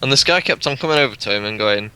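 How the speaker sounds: usual speaking voice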